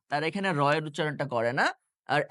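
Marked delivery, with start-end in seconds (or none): 2.1-2.2 আর with the r sounded, American style. Shown as a demonstration. Teacher shows how Americans say R, American আর. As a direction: say আর the American way, finishing on r. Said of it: "আমেরিকানরা বলে".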